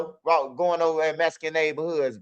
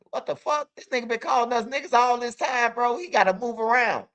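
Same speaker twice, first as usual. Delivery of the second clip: higher, retold disbelief at being insulted; a retold what-the-hell moment, pitched up; shocked outrage; 6 semitones higher than usual. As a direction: replay your own stunned reaction, pitched up. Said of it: "I'm like".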